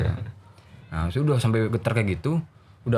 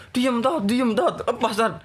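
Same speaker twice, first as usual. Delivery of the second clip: high-pitched pleas to be let go; urgent retold demand for release; acted struggling protest; 9 semitones higher, slower than usual. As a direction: mimic a restrained boy wriggling free. Restrained boy demands release.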